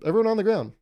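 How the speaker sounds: timid robber. Acted. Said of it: "Imagine a timid robber".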